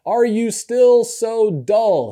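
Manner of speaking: Jesus scolding his dull disciples, exasperated; exasperated rebuke. Retold or imitated. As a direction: let the frustration show as you drag it out. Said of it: "he'll say stuff like".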